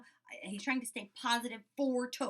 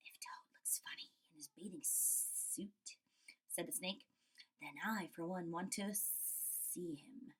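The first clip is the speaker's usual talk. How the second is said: slow, low, whispered snake voice; sly whispered snake; hissing snake; 5 semitones lower, 12 dB quieter, slower than usual. Acted. Snake wanting to see Toad, sly whisper.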